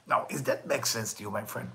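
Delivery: ordinary delivery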